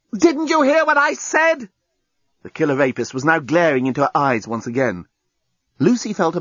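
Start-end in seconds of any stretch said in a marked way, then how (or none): 0.1-1.7 high, slow, insistent demand; exasperated demand. A narrator's reading. Captor glares at his screaming captive, exasperated. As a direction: spell it out with rising exasperation.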